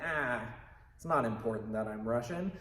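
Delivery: low, careless aside; dismissive. Acted. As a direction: mildly shrug it off, dropping the act. Acted dropping the character, shrugging it off.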